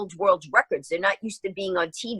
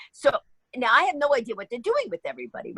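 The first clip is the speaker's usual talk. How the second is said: normal talking voice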